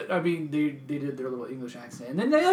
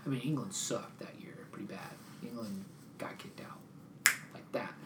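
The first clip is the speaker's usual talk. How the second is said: hushed, breathy aside about England; whispered aside; 2 semitones lower, 9 dB quieter than usual. Real, not acted. Telling friends England flopped, in a whisper. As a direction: drop to a whisper for the jab.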